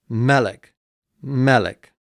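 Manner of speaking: melech, MEL stressed, LECH light; stress on first syllable. Shown as a demonstration. Teacher repeats melech for students, stress on first syllable. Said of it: "accent's on the first syllable".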